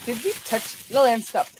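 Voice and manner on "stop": ordinary delivery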